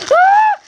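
sharp gasp into a high, loud squeal; a real thrilled gasp and cry; excited gasp. Real, not acted. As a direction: gasp and shriek with delight. Unboxing the prized cougar toy.

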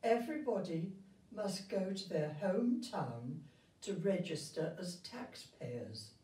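plain reading voice

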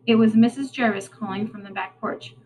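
plain reading voice

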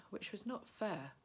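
usual speaking voice

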